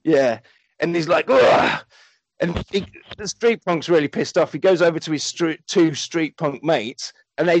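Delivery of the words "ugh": loud, high mock growl of disgust; acted-out annoyed "ugh"; disgusted grunt. Street punk with spilled drink, loud disgusted grunt.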